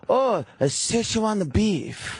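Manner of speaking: high, drawn-out mock Chinese accent. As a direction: announce it slowly in a high put-on Chinese accent. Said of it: "in that stereotypical Chinese accent".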